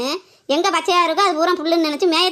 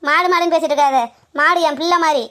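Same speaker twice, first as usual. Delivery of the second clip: louder, heated scolding; angry rant; 3 dB louder than usual. Acted. Woman scolding the landowner over her cow, angry loud rant. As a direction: tear into him, voice raised.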